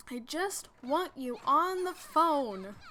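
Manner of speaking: loud, slow imitated wail; retold desperate plea. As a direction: plead loudly, stretching every word. Retold screamed plea to dad.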